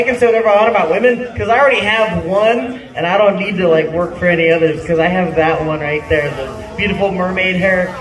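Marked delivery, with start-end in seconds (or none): none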